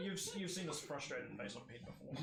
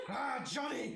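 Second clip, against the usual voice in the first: loud gruff dwarf shout; 10 semitones higher, 7 dB louder, slower than usual. Acted. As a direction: bellow it like an angry dwarf. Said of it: "the dwarf goes".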